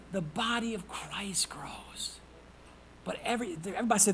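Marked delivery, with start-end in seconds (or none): 0.1-2.2 slow whispered repeat. Real, not acted. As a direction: breathe the words slowly. Preacher whispers the key line.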